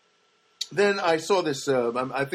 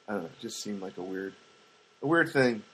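ordinary talk, nothing marked

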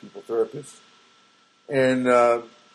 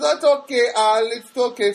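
high mock Filipino accent; pitched-up imitation of a Filipino therapist; [name], bad Filipino accent; 7 semitones higher, 4 dB louder than usual. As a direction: reassure in a hammed-up Filipino accent. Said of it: "terrible Filipino accent".

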